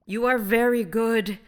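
loud, slow, high thanks; grateful exclamation. A narrator's reading. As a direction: exclaim it slowly to a benefactor. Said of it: "exclaimed the voice".

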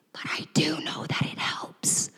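whisper. Retold or imitated. Conspiratorial aside to officer.

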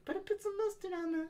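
very high, soft squeaky voice; mock baby voice. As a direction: play a tiny demanding baby. Same talking baby bit.

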